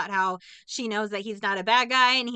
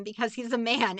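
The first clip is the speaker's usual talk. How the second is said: ordinary delivery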